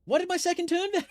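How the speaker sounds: can't believe the turn is gone; indignant disbelief. Real, not acted. Streamer demanding where the second turn went, outraged disbelief.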